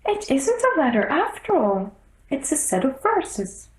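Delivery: anxious little rabbit pleading to the king. A narrator's reading. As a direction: squeak it out in a flustered hurry.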